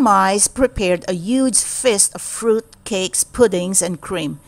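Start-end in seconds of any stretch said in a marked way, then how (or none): none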